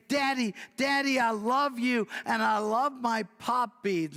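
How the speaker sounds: put-on a delighted four-year-old calling to her daddy; little girl greets her daddy with happy squeals; excited little girl.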